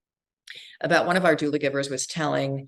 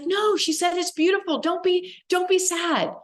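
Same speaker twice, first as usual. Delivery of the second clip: put-on much higher, childlike plea; a little girl saying don't be sad, pitched way up; little girl's voice. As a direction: voice the small girl insisting. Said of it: "this little girl ... was like".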